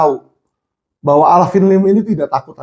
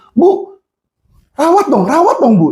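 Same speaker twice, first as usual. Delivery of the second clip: high, pressing request; urgent plea; 7 semitones higher than usual. Retold or imitated. Alvin urging the nurse to treat the patient, high and pressing. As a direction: push the nurse to help.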